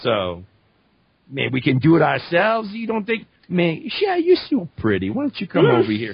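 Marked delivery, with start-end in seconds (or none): 1.3-6.1 higher, flirty Cajun voice; Gambit. Acted. Playing Gambit flirting with a teammate, Cajun come-on. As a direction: sweet-talk her in a Cajun accent. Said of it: "Gambit would be a totally different character".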